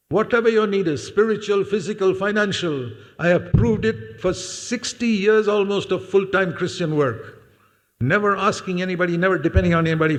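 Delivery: high, insistent testimony; impassioned preaching. Real, not acted. Preacher testifying to sixty years of provision, impassioned. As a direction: drive each point home on a high pitch.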